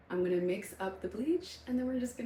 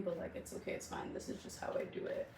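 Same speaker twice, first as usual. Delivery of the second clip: soft unvoiced reassurance; whispering; 8 dB quieter than usual. Real, not acted. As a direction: whisper it gently to viewers.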